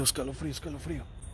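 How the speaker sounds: hushed, rapid repetition fading away; shivering chills. Real, not acted. Investigator feeling chills in a cemetery, quick shivering. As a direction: rattle it off softly through a shiver.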